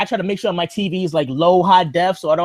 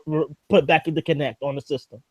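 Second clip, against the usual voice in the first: normal talking voice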